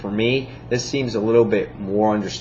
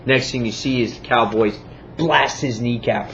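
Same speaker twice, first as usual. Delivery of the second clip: ordinary talk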